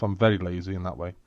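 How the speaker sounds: ordinary talk, nothing marked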